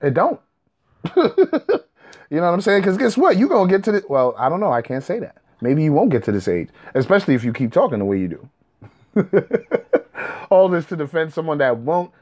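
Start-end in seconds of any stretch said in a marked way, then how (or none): 1.0-1.8 short high laugh; amused high-pitched laugh at the commenter; high mocking laugh. Real, not acted. 9.1-10.4 breathy chuckle. Real, not acted. Laughs at own jab.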